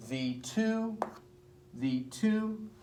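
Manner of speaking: "thee to" with "to" held high; short "thee", long lifted "to"; iambic stress. Shown as a demonstration. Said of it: "We're gonna cut it into feet".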